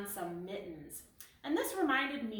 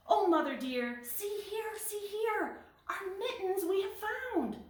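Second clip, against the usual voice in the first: much higher, squeaky kitten voices; happy kittens; 11 semitones higher than usual. Acted. Kittens showing their mother the found mittens, high and delighted. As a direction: chirp it out like delighted little kittens.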